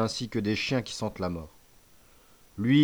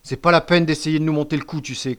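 loud, higher dismissive retort; cutting interruption; 5 semitones higher, 6 dB louder than usual. A narrator's reading. Boy rejecting the excuse, cutting in sharply. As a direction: cut the confession short scornfully. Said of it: "coupa la crique".

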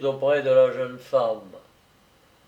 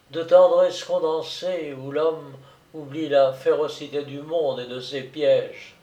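usual speaking voice

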